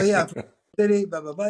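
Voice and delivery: put-on fast keen yes from a young New Yorker; eager reply. Answers the engineer eagerly.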